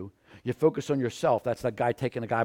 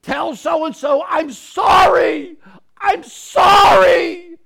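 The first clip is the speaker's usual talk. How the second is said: high, slow, anguished shouted plea; crying out sorry; 14 dB louder, much slower than usual. Retold or imitated. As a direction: wail it like a soul in torment. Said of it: "crying out to you and saying".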